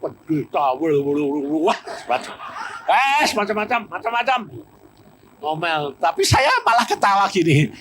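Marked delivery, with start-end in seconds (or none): 0.0-4.4 omelan meracau yang dibuat-buat; meniru omelan. Retold or imitated. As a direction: tirukan perempuan mengomel. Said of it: "Ngomel terus". (meniru istri yang mengomel sepanjang jalan)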